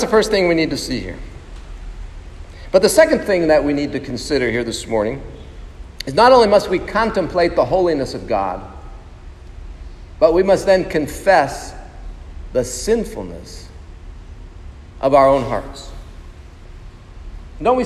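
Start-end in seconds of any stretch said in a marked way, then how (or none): none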